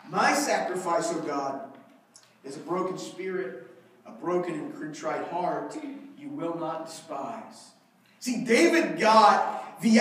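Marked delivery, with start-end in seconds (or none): 0.1-7.7 a quiet broken confession before God; hushed psalm. Acted. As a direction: let the words sink to a reverent hush.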